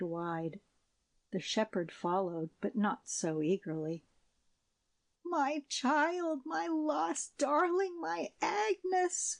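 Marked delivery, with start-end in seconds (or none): 5.2-9.4 very high, slow cry of reunion; joyful cry. A narrator's reading. Shepherdess crying out to her lost daughter, high. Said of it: "cried the shepherdess".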